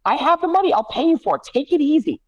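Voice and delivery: very high kid voice, imitated; imitating bossy child. Dad voicing Violet telling her sister to relax, high. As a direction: play a child bossily calming her sister. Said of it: "And Violet goes".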